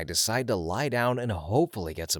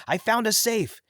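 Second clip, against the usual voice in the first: high-pitched narrated yell; 12 semitones higher, 3 dB louder than usual. A narrator's reading. Burglar telling his partner about the safe, yelling high. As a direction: call it out high to the other burglar. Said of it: "the other guy yelled".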